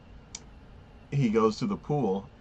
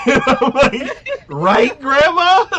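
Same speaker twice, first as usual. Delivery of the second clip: high, loud, laughing squeal; cracking up while asking; laughing disbelief; 15 dB louder, slower than usual. Real, not acted. Friend laughing at the grandma scene, high squeal. Laughing at absurd show moment.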